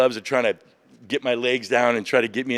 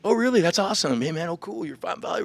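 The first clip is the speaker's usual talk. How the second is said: friendly delight; 9 semitones higher, faster than usual. Retold or imitated. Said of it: "just like".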